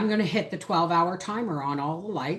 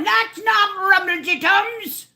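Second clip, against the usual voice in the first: imitating an indignant Scottish viewer, higher, louder and slow; hammed-up Scottish objection, drawn out; mock Scottish protest; 10 semitones higher, 5 dB louder, slower than usual. Scottish viewers correcting her recipe name in a mock Scottish accent. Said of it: "some Scottish people out there going".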